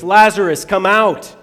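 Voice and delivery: calling out loud and high; loud cry. Acted. Jesus calling Lazarus from the tomb, loud cry. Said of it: "Jesus cried in a loud voice".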